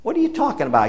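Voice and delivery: high-pitched, indignant outcry; a sharp, high retold protest; indignant outburst. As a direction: burst out in mock disbelief. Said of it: "got all upset".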